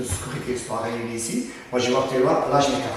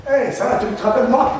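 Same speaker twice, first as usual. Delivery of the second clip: higher-pitched, casual accosting; put-on chummy hello; mock-friendly greeting; 7 semitones higher than usual. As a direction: greet him like an old acquaintance before the attack. Acts out accosting the man.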